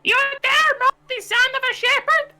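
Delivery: a piercing squeal of a voice; ear-splitting squeak. Pretend. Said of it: "That was an ear".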